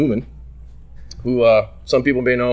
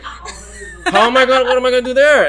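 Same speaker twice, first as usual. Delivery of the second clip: high, loud, laughing disbelief; mock-helpless outcry; retold exasperation; 11 semitones higher, 4 dB louder than usual. His exasperated reaction, joking.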